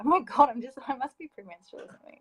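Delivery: laughing through sudden tears, surprised at herself; about to cry. Real, not acted. Choking up while telling her partner story, laughing through tears. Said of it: "I'm gonna cry".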